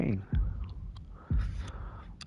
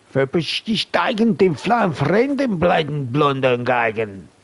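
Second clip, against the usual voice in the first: higher, louder mock German, half sung; mock German gibberish; 4 semitones higher, 5 dB louder than usual. Gamer playing a German war game, hammy mock German gibberish. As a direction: ham up fake German like a war film.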